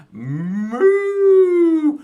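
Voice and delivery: loud, high, drawn-out moo; cow moo. Pretend. Host plays the cow in farm song, mooing loudly. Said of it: "he makes a sound like this".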